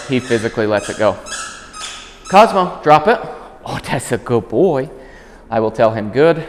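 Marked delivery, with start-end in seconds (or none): 2.3-3.2 high, louder "Cosmo, drop it"; firm command. Shown as a demonstration. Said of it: "when I say drop it". 3.6-4.9 praising the dog. Real, not acted.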